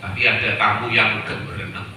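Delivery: ordinary delivery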